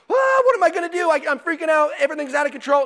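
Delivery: high, loud mock freak-out; mock panic. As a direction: panic in a hammed-up way. Acting out panic without faith.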